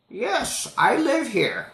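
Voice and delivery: put-on old lady mishearing the question; high-pitched confused old woman. Old lady voiced in story.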